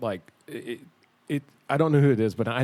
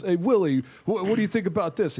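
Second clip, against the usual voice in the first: put-on higher-pitched Jersey kid seeking approval; eager Jersey guy; 7 semitones higher than usual. Jersey guy showing off to old bluesman, eager. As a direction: imitate a keen Jersey guy. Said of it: "he's like this Jersey guy".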